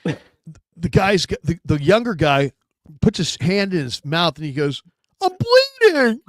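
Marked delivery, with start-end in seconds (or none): 5.2-6.2 put-on high-pitched childish wail; hammed-up tearful whine; mock crying. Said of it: "he starts to cry like a child".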